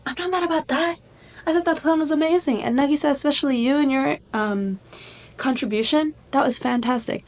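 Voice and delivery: a hint of a delighted teacher gushing; teacher surprising the class by praising the plan, lightly voiced high and chipper; chirpy octopus teacher.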